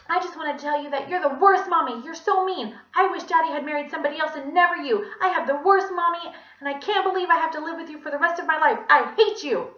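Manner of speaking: put-on high, loud, bright energetic kid; Joe. A narrator's reading. Villain posing as a child insulting mommy by phone, energetic. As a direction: rattle it off as a tantruming child. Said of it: "he had a bright, energetic voice".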